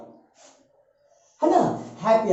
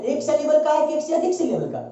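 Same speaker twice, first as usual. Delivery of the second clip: ordinary talk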